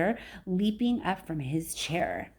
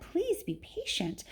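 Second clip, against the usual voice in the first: soft pleading; 10 semitones higher, 7 dB quieter, slower than usual. Acted. Father pleads softly.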